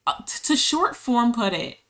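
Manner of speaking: ordinary talk, nothing marked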